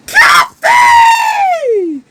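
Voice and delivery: very high, piercing call of a name; a distant shout into the empty room. Acted. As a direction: yell the name out in alarm. Calls out for missing Cafe.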